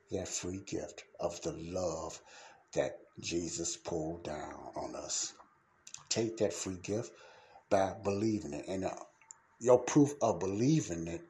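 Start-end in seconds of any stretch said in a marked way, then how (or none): none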